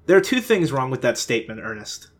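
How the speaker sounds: ordinary talk, nothing marked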